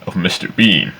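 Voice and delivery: ordinary talk, nothing marked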